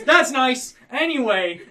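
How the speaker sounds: offhand showman brush-off; dismissive host. Acted. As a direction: wave it away and move on. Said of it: "he goes".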